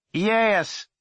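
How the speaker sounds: short loud high reply; called answer. A narrator's reading. Boy answering his mother downstairs, called out. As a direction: shout it down the ladder.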